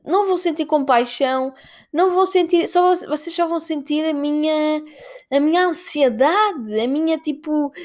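ordinary talk, nothing marked

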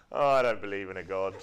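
sneering mock atheist, half laughing. Preacher mocking the atheist at the door, sneering. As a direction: scoff like a smug unbeliever.

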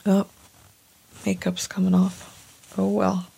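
laughing at herself through tears; teary. Real, not acted. Vlogger wiping her eyes on camera, teary and soft. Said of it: "These are happy tears".

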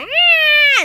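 high, loud screaming roar; monster roar. Retold or imitated. King Kong screaming back at Godzilla, high loud roar. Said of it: "King Kong was like".

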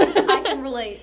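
loud laugh through the words. Real, not acted. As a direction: laugh it out loudly. Laughing agreement after joke.

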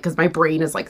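ordinary talk

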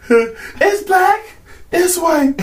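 high squeaky switch from one to the other; hammed-up high voice flipping its answer; mock flip-flop. Acting out a quick switch.

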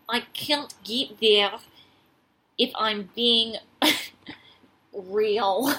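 slow, badly faked Russian accent; a shaky stab at a Russian accent, drawn out. Friend asks her to redo Christina in a Russian accent, slow and faked. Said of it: "doing the Russian accent again".

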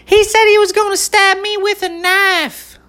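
very high, squeaky mimic of a tattling girl; shrill up-high parody of a classmate telling on him; mock girly accusation. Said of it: "She goes".

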